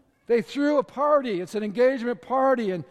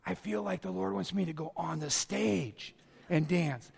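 much softer, hushed earnest plea; soft earnest lady; 5 semitones lower, 9 dB quieter than usual. Retold or imitated. Lady approaching pastor during worship, softer earnest request. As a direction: plead quietly with the pastor. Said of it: "She said".

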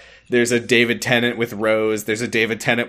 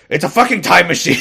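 high, loud mock exasperation; hammed-up impatient shout; exasperated outburst; 7 semitones higher, 5 dB louder, faster than usual. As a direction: burst out as if fed up. Acting the Doctor's exasperated reveal.

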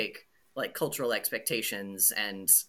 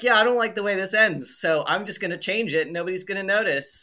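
raised, breezy, offhand; cocky translator voice; 6 semitones higher, 5 dB louder than usual. Retold or imitated. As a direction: toss it off like it's no big deal. Voicing the Icelandic translator.

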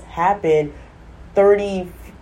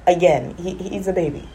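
normal talking voice

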